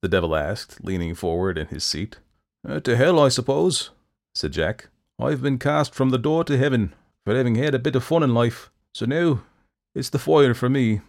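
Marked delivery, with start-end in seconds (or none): 2.7-11.0 raised, rueful rogue; Jack voice. A narrator's reading. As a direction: shrug it off ruefully. Jack resigned.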